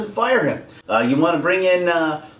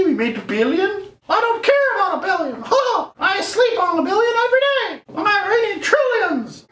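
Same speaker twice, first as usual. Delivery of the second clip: much higher cackling studio boss voice; mock greedy executive. Mocking Disney bosses to viewers, high gloating laugh. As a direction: play a gloating money-hungry executive, laughing.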